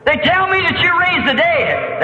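high-pitched heckler calling out; hollering. Retold or imitated. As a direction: holler it across a crowd as a taunt. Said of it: "Some of them hollering".

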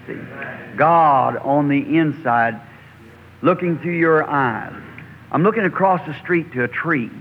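usual speaking voice

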